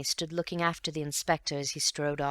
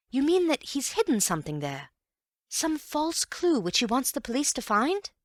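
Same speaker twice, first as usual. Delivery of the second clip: eager questions pitched higher; Bill racing ahead with the idea, higher; excited; 10 semitones higher, 4 dB louder than usual. Acted. Bill guesses Cayley planted a false clue, excited and higher. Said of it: "Bill was rather excited again".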